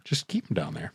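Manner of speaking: soft, low echo; quiet. Real, not acted. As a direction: murmur it back. Echoed softly.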